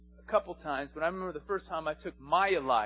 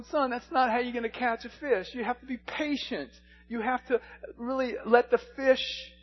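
raised, loud, insistent fatherly appeal; impassioned father; 7 semitones higher, 9 dB louder than usual. Retold or imitated. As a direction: plead with a son, building to a forceful preacher's cry. Father's lesson turns into impassioned appeal.

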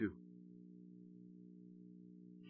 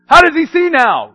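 loud interrogating; 10 semitones higher, 9 dB louder than usual. Retold or imitated. Leaders questioning parents.

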